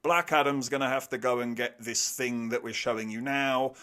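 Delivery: usual speaking voice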